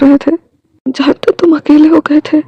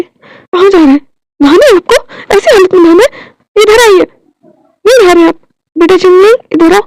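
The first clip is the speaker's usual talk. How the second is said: higher and louder, tearful scold; worried wife scolding him not to bathe; 6 semitones higher, 4 dB louder than usual. Acted. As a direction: order him to stay put. Wife scolds injured husband.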